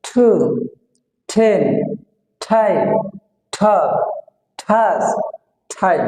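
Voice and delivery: "ঠু", "ঠেন", "ঠাই" each with a puffed t; aspirated T drill. Shown as a demonstration. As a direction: separate words, strong puff opening "ঠক". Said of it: "তাহলে কি হবে?". (মহাপ্রাণ ঠ দিয়ে "ঠু", "ঠেন")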